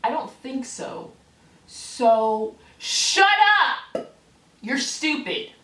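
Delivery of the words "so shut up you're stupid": sudden loud, high outburst; exasperated shout. Real, not acted. Ranting at offended commenters, exasperated shout. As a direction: explode at them to shut up.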